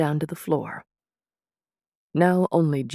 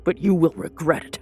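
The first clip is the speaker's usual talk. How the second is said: a fast, louder snarl of rage; snarled threat; 4 dB louder, faster than usual. Acted. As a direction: spit it out at the sorcerer, furious.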